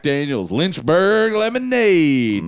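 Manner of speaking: slow, drawn-out reveal; holding up the bottle for the room; showman. Acted. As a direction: present it like a game show host. Mock-showing a bottle.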